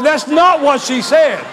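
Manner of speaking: loud, high, slow protest; mock-outraged, every word drawn out; emphatic retold objection. Disbelieving reply to false interpretation.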